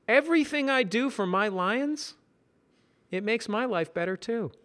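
high, lighter voice; Simon voice. Acted. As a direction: share it contentedly.

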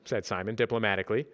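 ordinary delivery

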